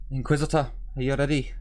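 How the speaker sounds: put-on sergeant on the comms in a Scottish accent; light Scottish lilt on "Inquisitor, are you ready". Sergeant over comms to the Inquisitor, light Scottish accent. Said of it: "Did she have a Scottish accent?".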